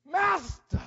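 calling Master out high and loud; preacher shouting Master to the church. Shown as a demonstration. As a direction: shout Master to rally the congregation. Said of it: "Somebody out there say".

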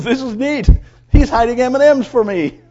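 high, loud, gleeful pup voice; excited dog. Acted. Dog thinks her owner hid candy, excited. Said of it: "she goes".

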